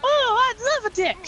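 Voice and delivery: very high, loud cartoon flower squeal; squeaky flower voice. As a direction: mimic the Mario Sunshine flowers in a tiny high voice. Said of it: "What are they saying?".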